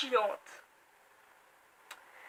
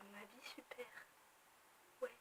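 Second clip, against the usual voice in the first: sarcastic whisper; 25 dB quieter than usual. Real, not acted. Sarcastic aside on her life.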